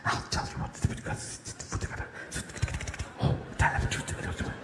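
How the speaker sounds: whispered reply. Retold or imitated. Whisperer whispering back when asked to speak up. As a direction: keep whispering it. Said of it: "horse whisperers".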